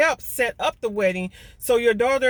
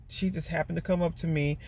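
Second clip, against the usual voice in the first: ordinary talk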